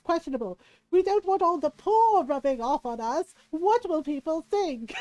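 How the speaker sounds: loud, hammed-up society lady, aghast; Katharine Hepburn. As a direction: play a scandalized snob, drawing out key words. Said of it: "calls it my Katharine Hepburn voice".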